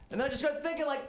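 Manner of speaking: ordinary delivery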